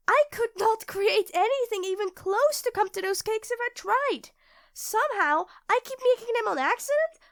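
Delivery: high, loud, frantic outburst; hysterical panic. Acted. Refusing her partner's plea to calm down, loud hysterical panic. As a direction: lose it completely, voice climbing.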